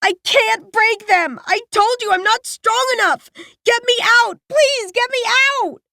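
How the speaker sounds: put-on high, panicked girl begging; raw terror. A narrator's reading. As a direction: scream to be let out. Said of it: "the raw terror carried on her words".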